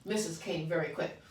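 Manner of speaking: ordinary delivery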